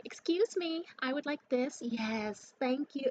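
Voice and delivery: high, loud mock Valley girl.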